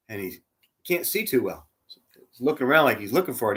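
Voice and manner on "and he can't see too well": normal talking voice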